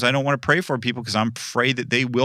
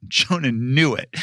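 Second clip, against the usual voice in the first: normal talking voice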